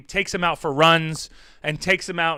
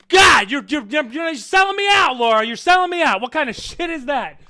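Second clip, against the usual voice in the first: high, loud shouted accusation; hammed-up betrayed shout; mock outrage shout; 9 semitones higher, 7 dB louder than usual. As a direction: yell like you've been betrayed, for laughs. Accusing Laura of selling him out.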